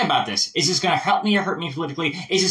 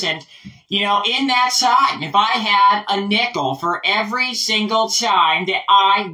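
Hillary Clinton; 3 semitones higher than usual. Acted. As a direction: reassure a panicking crowd in measured phrases. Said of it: "we have to calm people down".

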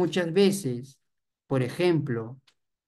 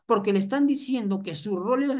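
ordinary talk

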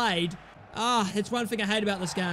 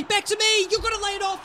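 higher, louder demand for the pass; shouting for the ball; 9 semitones higher, 3 dB louder, faster than usual. Real, not acted. Gamer yelling at his striker for the pass, shouting. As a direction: yell it at your teammate, insistent.

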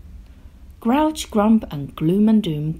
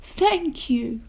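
grateful echo; 7 semitones higher than usual. A narrator's reading. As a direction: say it small and high, not booming. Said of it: "remembered what their big bad monster".

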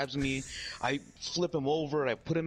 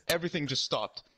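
ordinary talk, nothing marked

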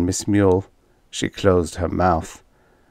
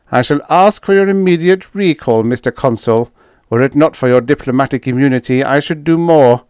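high, louder official protest; indignant alien official; 6 semitones higher, 7 dB louder, faster than usual. A narrator's reading. Fifth threatening the consul with recall, indignant. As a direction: lodge a formal threat in a high voice.

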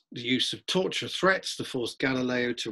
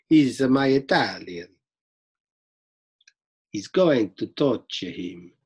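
bad Italian accent; 4 dB louder than usual. Lecturer jokes about playing the Pope, bad Italian accent. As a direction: put on a terrible stage Italian. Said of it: "probably worse than my Russian accent".

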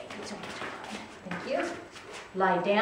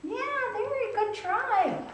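high-pitched praise. Real, not acted.

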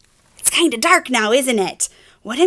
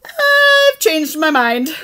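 higher, loud, slow pigeon; firm decision; 7 semitones higher, 9 dB louder, much slower than usual. Acted. Pigeon giving up on the puppy, slow and grand. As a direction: announce it grandly.

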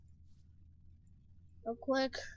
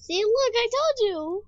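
triumphant, much higher and louder; excited shout; 11 semitones higher, 12 dB louder than usual. Real, not acted. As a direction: yell it, proven right. Proven right about the impostor.

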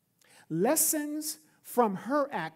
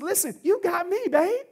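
smug husband; 11 semitones higher, 6 dB louder than usual. Retold or imitated. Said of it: "He says".

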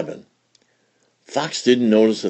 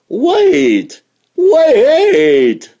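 high loud desperate call; 8 dB louder than usual. A narrator's reading. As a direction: cry out after her. Fox calling after Flossie.

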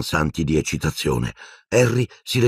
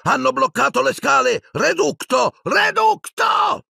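very high shouting, climbing higher; desperate shout. A narrator's reading. Fighter below shouts spell at blocked stairs, shouting. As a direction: shout the spell, stretching the last one. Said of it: "una voce gridò".

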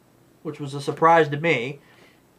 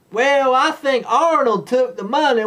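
mock Southern accent; 8 semitones higher than usual. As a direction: lay the Southern accent on thick. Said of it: "her accent came and went".

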